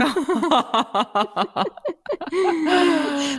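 long high-pitched laugh. Real, not acted. Host cracks up at the Luna Park story, laughing.

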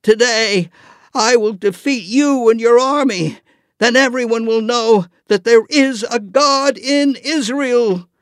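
high, loud defiant shout. Acted. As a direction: shout it across the valley. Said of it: "David shouted".

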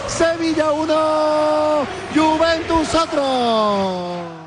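slow stretched score call; drawn-out scoreline. Real, not acted. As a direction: stretch every word of the score. Scoreline call after goal.

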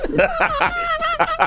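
squealing high laugh. Real, not acted. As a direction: burst into laughter. Host laughing.

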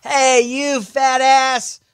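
put-on high, slow taunting kid; bratty kid voice. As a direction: taunt the driver like a brat. Kid insulting driver.

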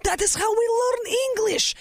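high-pitched imitation of an immigrant mother; excited mom. Mom thrilled by the TV star, high excited voice. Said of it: "And my mom was like".

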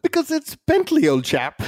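hammed-up English accent, starting high; jokey high-pitched English toff accent; mock English accent. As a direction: exaggerate the English gent, pitch swooping down. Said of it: "having to do the English accent".

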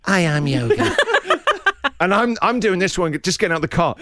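ordinary talk, nothing marked

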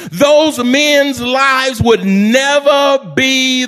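high, ringing, drawn-out preacher delivery; full-force preaching. Real, not acted. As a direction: preach it big, stretching the words.

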